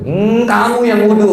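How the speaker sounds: high, mock-cross retort; exaggerated irritated wife; wife snapping back. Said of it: "Istri saya bilang".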